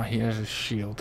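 ordinary talk

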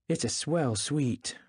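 ordinary delivery

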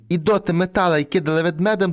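plain reading voice

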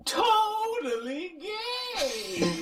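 high drawn-out laughing yell; laughing shout. Real, not acted. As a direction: stretch it out slowly, laughing.

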